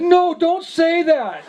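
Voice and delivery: high, slow, whiny protest; like a little kid. Retold or imitated. Old priest protesting no sweets, whining like a kid. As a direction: whine it like a child told no. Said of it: "Like a little kid".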